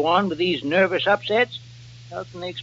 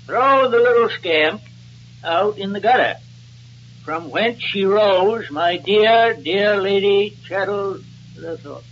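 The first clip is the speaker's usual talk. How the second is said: slow, projected melodrama delivery; stagey declaiming. Acted. Actor told to proceed in rehearsal, stagey declaiming. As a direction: declaim it grandly, word by drawn-out word.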